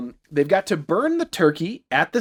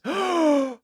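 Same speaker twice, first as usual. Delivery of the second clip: sharp high mock gasp; hammed-up gasp; 12 semitones higher than usual. Recapping the turkey hunting their friends, with a hammed-up gasp. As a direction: gasp high like a horror-movie victim. Sudden realisation of Billy's danger.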